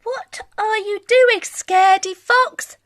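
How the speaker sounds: a hint of a curious cub. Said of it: "asked Careful Cub".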